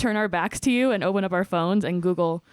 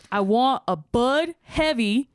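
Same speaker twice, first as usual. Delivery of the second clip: slow blunt customer; 3 semitones higher, slower than usual. Retold or imitated. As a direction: spell it out like to a fool. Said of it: "He's like".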